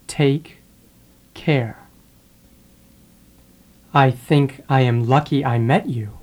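ordinary talk, nothing marked

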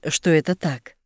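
usual speaking voice

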